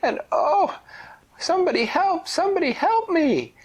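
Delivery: very high, loud, fast squeaky cries for help; pained pleading cry. Acted. Stranded pufferfish calling for help on the beach. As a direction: plead in a tiny squeaky fish voice. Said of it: "laying on this beach going".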